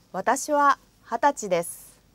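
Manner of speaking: usual speaking voice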